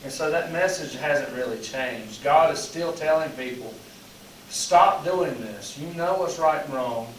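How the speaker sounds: ordinary delivery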